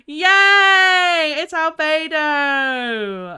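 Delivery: long, loud, high yell; triumphant cheer. Real, not acted. Streamer whose guess was right, triumphant drawn-out cheer. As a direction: cheer it out slowly at full voice.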